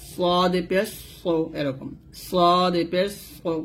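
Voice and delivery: "সদ পেশ সো" slow, thick rounded s; heavy Arabic sad. Shown as a demonstration. Teacher showing learners heavy sad, thick "সো". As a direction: stretch "সদ", keep "সো" dark and full. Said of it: "এরকম উচ্চারণ করতে হবে".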